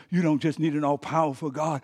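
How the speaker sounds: usual speaking voice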